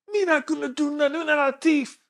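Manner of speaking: high-pitched mock Jamaican protest; Jamaican accent. Rider refusing the officer's plea, high imitated Jamaican accent. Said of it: "he's a Jamaican guy".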